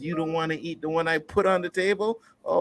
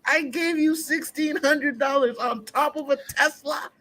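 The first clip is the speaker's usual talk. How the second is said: much higher, indignant ranting voice; mock outraged parent; 13 semitones higher than usual. Parent scolding the ungrateful daughter, mock outraged and high. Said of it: "I'm like".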